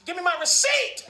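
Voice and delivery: demanding. Retold or imitated. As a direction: push hard for the receipt. Demanding receipt.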